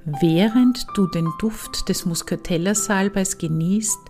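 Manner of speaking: calm, unhurried meditation voice with long pauses; guided meditation. Acted. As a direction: ease the listener into relaxation. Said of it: "Weiter geht es nun mit einer Meditation".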